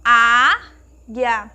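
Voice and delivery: águia split, with a loud, long "á"; stress on first syllable. Shown as a demonstration. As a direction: hold the "á" of águia, pause, then "guia". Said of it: "está na sílaba mais forte". (águia com "á" forte e separado)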